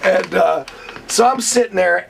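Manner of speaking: ordinary delivery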